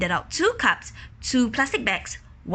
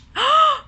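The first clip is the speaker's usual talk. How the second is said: gasp; 7 dB louder than usual. Acted.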